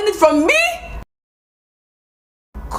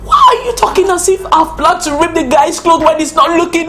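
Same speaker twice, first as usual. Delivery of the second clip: ordinary talk, nothing marked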